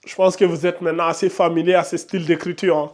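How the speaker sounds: normal talking voice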